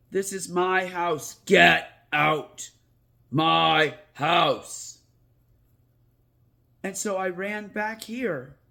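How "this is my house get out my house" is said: a ghost claiming his house, stern and loud; ghostly order. Retold or imitated. Ghost telling the boy to leave his house, stern loud command.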